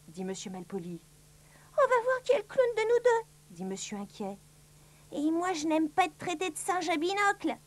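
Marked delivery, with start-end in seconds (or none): 1.8-3.3 high-pitched character voice; high cartoon challenger; Monsieur Inquiet. 5.1-7.6 higher, offended character voice; put-on indignant little voice; Monsieur Malin.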